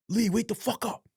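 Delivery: high, harsh mock guard yell; guard rousing a prisoner at three in the morning, mock yell; guard barking order. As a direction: bark it like a guard waking an inmate. Guard wakes him again.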